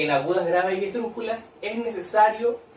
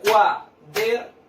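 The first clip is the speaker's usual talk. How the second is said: "Cuaderno" slowly clapped out as cua, der, no; split into syllables; 4 dB louder, much slower than usual. Shown as a demonstration. Teacher has children clap along, syllable by syllable. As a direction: chant "Cuaderno" in three spaced beats. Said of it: "pueden ir utilizando las palmitas".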